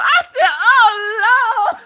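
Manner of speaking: very high squeal breaking into laughter; laughing shriek. Real, not acted. Reacting to the retold confession, shrieking with laughter.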